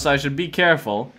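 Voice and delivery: ordinary delivery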